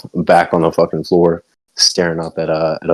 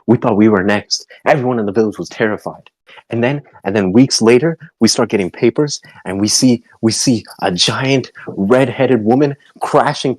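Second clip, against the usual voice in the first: higher, ranting mother building to shrill; furious mom; 6 semitones higher than usual. Acted. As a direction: pile on the outrage like a furious mother. Mother's long angry tirade.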